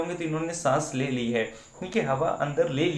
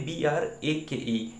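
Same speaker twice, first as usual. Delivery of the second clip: spelling out; slower than usual. Shown as a demonstration. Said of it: "spelling क्या होती है".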